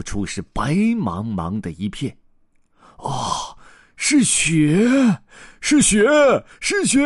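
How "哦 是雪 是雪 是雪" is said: rising higher with each cry of joy; thrilled little elephants spotting snow; excited cries. Acted. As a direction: shout it in delight at the snow. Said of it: "他们叫起来".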